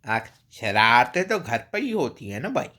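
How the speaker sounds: animated Bhojpuri accent with swooping pitch; Bhojpuri accent imitation. Shown as a demonstration. Vlogger imitating for Indian friends, Bhojpuri accent. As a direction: play up a lively, sing-song Bhojpuri accent. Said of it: "imitation of a Bhojpuri accent".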